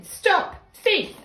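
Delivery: high, loud shouted alarm; two loud, high, punched cries; angry shout. A narrator's reading. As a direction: shout it as the chasing gardener. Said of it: "waving a rake and shouting".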